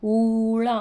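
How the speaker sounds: ఊళ with a long, loud ఊ; long vowel demo. Shown as a demonstration. Teacher showing kids how ఊళ is pronounced. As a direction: draw out the ఊ in ఊళ. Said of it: "ఇది అలా చూడండి ఎలా పలుకుతున్నానో".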